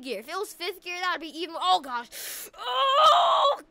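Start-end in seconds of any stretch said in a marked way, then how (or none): 2.5-3.6 long, high, loud wail; drawn-out alarmed "oh"; panicked cry. Pretend. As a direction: cry out in dread of a crash. Acted car careering out of control.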